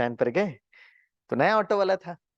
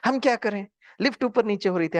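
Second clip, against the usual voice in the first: higher irritated "हम क्या करें?", snapped back; angry; 6 semitones higher than usual. Retold or imitated. Retelling his retort to the driver, mildly angry. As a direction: snap "lift ऊपर नीचे हो रही थी" defensively. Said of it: "हमने क्या कहा?".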